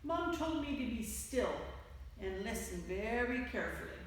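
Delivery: ordinary delivery, nothing marked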